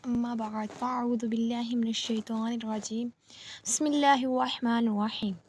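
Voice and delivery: measured, chanted opening invocation; Arabic recitation. Acted. Opening recitation before the lesson. (अरबी अंदाज़ में धीमी तिलावत)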